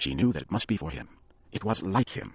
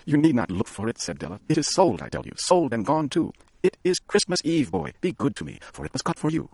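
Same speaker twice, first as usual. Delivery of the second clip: insistent Della; 8 semitones higher than usual. A narrator's reading.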